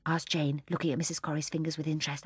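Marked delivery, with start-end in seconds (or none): none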